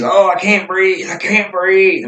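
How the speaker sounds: high-pitched mock gasping plea; imitated drunkard's complaint. Imitates drunkard claiming he can't breathe.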